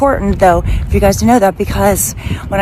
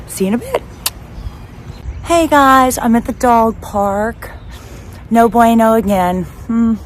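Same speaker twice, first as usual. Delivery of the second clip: usual speaking voice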